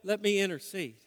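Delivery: loud, high, driving sermon voice; a preacher building to a climax; preaching at full force. Acted. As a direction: press the promise home with fervour. Sermon climax, preacher pressing hard.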